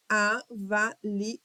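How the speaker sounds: "avaliação" split as a-va-li-a-ção; syllable by syllable. Shown as a demonstration. Teacher checking syllable division, "avaliação" syllable by syllable. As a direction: sound out "avaliação" in detached beats. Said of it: "divisão silábica de todas as palavras".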